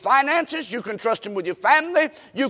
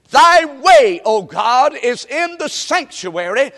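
loud, raised pulpit delivery; scripture proclamation; 3 semitones higher, 6 dB louder, slower than usual. Real, not acted. Preacher proclaims the verse God gave him, loud and emphatic. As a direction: ring out the key verse. Reads key verse aloud.

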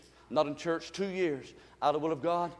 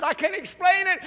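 high-pitched, mildly emotional quote; retold emotional outburst; 10 semitones higher, 5 dB louder than usual. Waitress confiding in her pastor, retold emotionally. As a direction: retell it as if getting overwhelmed. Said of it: "She was getting real emotional".

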